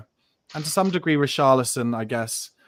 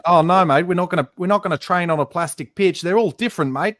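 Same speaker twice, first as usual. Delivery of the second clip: hammed-up Australian accent; Aussie impression; 2 semitones higher, 3 dB louder than usual. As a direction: put on a soap-opera Aussie twang. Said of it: "Is that your best Aussie impression?".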